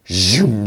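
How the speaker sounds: voiced zoom sound effect; lightsaber hum. [name] turns on the lightsaber, whooshing sound effect. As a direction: imitate a lightsaber switching on.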